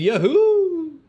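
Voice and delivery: high, loud drawn-out yahoo; whooping cheer. Real, not acted. Streamer cheering New Zealand's announcement time, loud whoop. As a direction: let out a big celebratory whoop.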